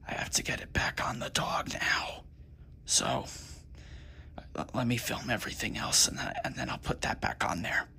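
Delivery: hushed, mostly unvoiced whisper. Real, not acted. Creator telling viewers about putting the recording back, whispering. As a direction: drop down into a soft whisper. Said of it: "I don't know why I'm whispering".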